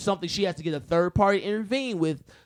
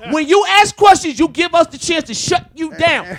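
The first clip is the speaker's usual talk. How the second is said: loud, high yelling overloading the mic; clipping distorted mic; 8 semitones higher, 9 dB louder than usual. Real, not acted. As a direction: shout straight into the mic, distorting. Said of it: "Stop yelling into the mic".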